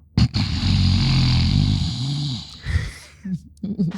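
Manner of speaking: long throaty growl; pure growl. Pretend. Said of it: "just do a pure growl".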